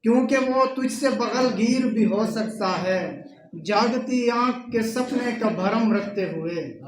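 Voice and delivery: poetry recitation. Acted. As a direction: recite the couplet to the gathering, line by line. Recites couplets at a mushaira. (मुशायरे के अंदाज़ में शेर पढ़ना)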